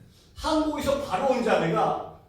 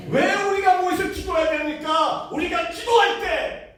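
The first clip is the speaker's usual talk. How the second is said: fiery preaching; 10 semitones higher, 4 dB louder than usual. Acted. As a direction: preach it to the rafters. Preacher rises to climax.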